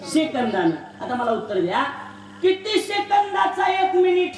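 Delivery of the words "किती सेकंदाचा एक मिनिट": calling out. Real, not acted. Kirtankar calls question to crowd.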